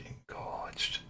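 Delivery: soft whisper into an ear. Pretend. Man pretending to whisper in his missus's ear, soft whisper. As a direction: lean in and whisper it. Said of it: "whisper in her ear".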